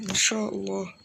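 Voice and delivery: ordinary talk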